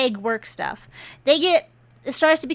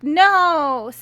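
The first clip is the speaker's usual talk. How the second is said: higher, louder mock cry; acted cry of protest; 4 semitones higher, 6 dB louder than usual. Hostage daughter as the gun is pointed, acted cry. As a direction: cry out as the frightened hostage, briefly.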